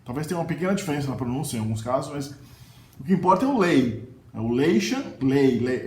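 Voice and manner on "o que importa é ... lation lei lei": lei punched loud; ênfase em lei; stress on lei. Shown as a demonstration. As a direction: hit the syllable lei hard. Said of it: "Esse lei é a sílaba tônica".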